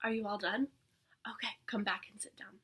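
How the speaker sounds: soft whispered instructions. Acted. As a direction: whisper to the kids.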